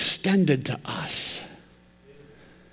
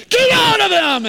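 high, loud yelling at a demon; hammed-up screaming preacher; mock exorcist shout; 5 dB louder than usual. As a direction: bellow it like a showy pastor. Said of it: "the religious person's like".

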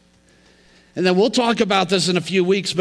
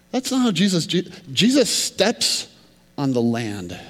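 usual speaking voice

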